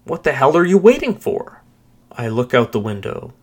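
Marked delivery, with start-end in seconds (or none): 0.0-1.6 loud, high, impatient demand; bellowing. A narrator's reading. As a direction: bellow it with pitch climbing to the end. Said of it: "bellows to the cabbie".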